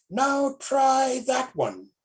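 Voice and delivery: slow, high, taunting dare; older brother goading the little one; challenging big brother. Acted. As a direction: dare him slowly.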